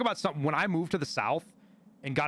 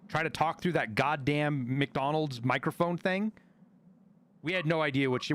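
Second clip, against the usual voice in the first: ordinary talk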